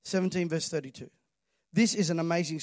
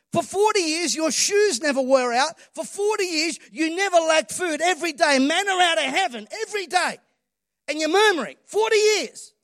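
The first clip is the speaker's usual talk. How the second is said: a higher, louder scolding as God; voicing God's rebuke; 8 semitones higher, 5 dB louder than usual. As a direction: thunder at them like an exasperated God. God rebukes the murmuring people.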